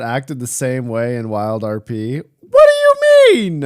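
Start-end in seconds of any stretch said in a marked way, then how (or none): 2.2-3.6 squeaky high indignation; outraged, voice jumps way up; indignant surprise. Real, not acted. Indignant at being called out.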